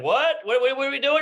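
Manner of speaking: put-on high whiny startled kid voice; squeaky bewildered child asking; confused little kid.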